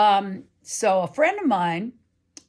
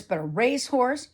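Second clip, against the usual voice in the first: ordinary talk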